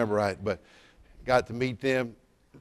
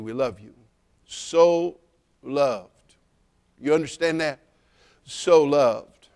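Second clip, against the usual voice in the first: ordinary talk, nothing marked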